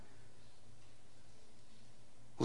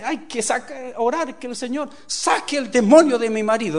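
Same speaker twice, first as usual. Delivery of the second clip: high, urgent, rising louder; imitated pleading wife; 7 semitones higher, 5 dB louder than usual. Preacher mimicking a wife blaming demons, mock pleading. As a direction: beg for prayer with comic urgency. Voices a beaten wife seeking prayer.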